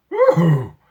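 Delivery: loud moaning animal call; camel moan. A narrator's reading. Camels rear up and moan at the bombs, loud moan. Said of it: "camels ... moaned".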